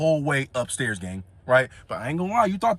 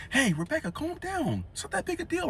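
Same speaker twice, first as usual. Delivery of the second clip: high, softer friend voice soothing Rebecca; friend calming her down; 10 semitones higher, 7 dB quieter than usual. Retold or imitated. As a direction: imitate the friend, pitched up and hushed. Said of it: "was like".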